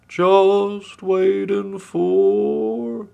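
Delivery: high, loud, stretched-out sing-song; idle pig. A narrator's reading. Pig innocently passing time on the bridge, drawn-out high voice. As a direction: draw every vowel out slowly and high.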